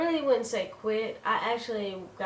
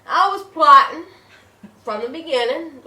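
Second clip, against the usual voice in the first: emphatic; 6 semitones higher, 13 dB louder than usual. Real, not acted. Emphatic confession.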